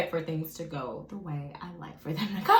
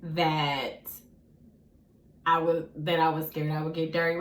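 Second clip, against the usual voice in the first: normal talking voice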